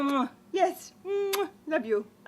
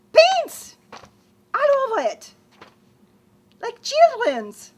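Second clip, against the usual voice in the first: high, loud French disgust; slow horrified French complaint; appalled French outburst; 8 semitones higher, 6 dB louder, slower than usual. Acted. Appalled report to Papa.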